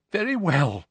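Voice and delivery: high, strained concession; voice cracking. A narrator's reading. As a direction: give in with a cracking voice. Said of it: "her voice cracking".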